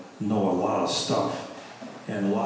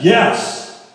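angry yes; 9 semitones higher, 8 dB louder than usual. Retold or imitated. Angry co-pastor slams the door.

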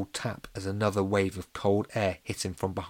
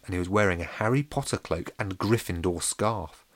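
plain reading voice

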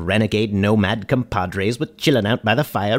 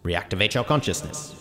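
a lightly voiced eerie radio outro; hushed ghostly outro read; spooky announcer outro; 3 semitones lower, 5 dB quieter than usual.

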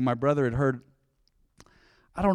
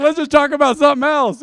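higher, louder teasing brush-off; mock attitude; 9 semitones higher, 7 dB louder than usual. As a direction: brush him off playfully. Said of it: "I said".

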